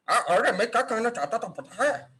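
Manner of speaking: character with an immobile upper lip; heavy upper lip. Shown as a demonstration. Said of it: "कुछ-कुछ लोग ऐसे बात करते हैं".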